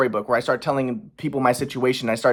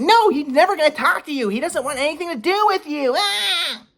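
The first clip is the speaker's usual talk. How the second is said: high, shrill-free mock mom, much higher; 13 semitones higher than usual. Son mimics mom refusing him, high mock voice. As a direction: mimic his mom's refusal, pitched way up.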